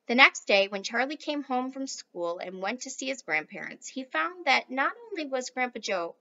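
ordinary delivery, nothing marked

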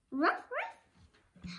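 high barking ruff ruff; dog bark. Acted. Boyd barking for Kung Pao chicken, high yaps. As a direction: yap brightly like a happy dog. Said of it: "barks the Boyd".

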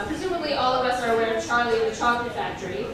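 ordinary delivery

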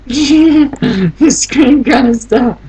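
high, slow laughing echo; laughing repeat. Real, not acted. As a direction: drawl it out through a laugh. Mocking repeat of own phrase.

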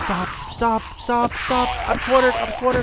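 high, loud frantic begging; panicked pleading. Real, not acted. Streamer cornered by zombies, panicked high pleas. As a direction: beg them to stop.

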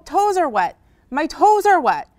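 high, loud, amazed cry; excited discovery. Acted. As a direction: exclaim it as a thrilling find. Gecko discovers water.

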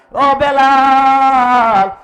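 high, loud, long-held call; ringing drawn-out summons. Acted. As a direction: cry the name out, stretching it slowly. Prophet calls Bilal in story.

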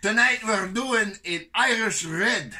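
high, loud hammed-up Irish brogue that slides into Scottish; Scottish-sounding fake Irish voice; mock Irish accent. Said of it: "That's still Scottish, my dude".

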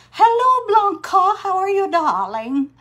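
high, loud gushing hello; light up to greet a friend arriving; excited greeting. Real, not acted. Greeting a viewer in chat.

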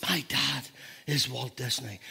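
soft, low, halting wonder; awestruck. Retold or imitated. Little girl marvelling at who her dad is, awestruck. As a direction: let it sink in quietly, in imitated awe. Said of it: "she walked around in awe".

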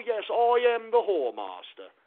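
usual speaking voice

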